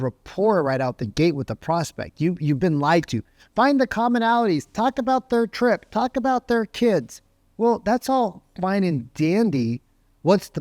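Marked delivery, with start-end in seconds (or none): 3.5-7.2 high-pitched singsong list of tips; mock chirpy trainer, voice lifted; mocking advice. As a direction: parrot bad sales advice dismissively. Mimics the bad advice agents hear.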